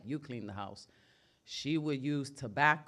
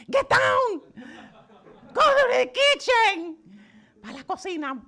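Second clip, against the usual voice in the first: much higher, louder frantic yell; panicked shout; 12 dB louder than usual. Retold or imitated. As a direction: scream at the kids to hide. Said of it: "she'd be like".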